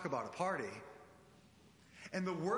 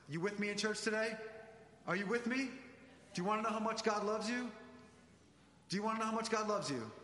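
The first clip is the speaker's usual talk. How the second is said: higher, rousing call to the church; preaching call-out; 4 semitones higher than usual. Real, not acted. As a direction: fire up the congregation. Preacher calls out to congregation.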